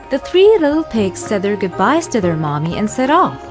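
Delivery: ordinary delivery, nothing marked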